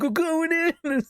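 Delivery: over-the-top raid cry; action hero agent. Retold or imitated. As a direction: ham it like a movie raid. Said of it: "It's like".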